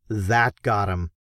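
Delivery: ordinary delivery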